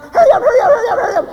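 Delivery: high, loud, rapid repeated holler; urgent yelling. Acted. Husband yelling to the women in the bathroom, frantic. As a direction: yell it frantically to rush someone along.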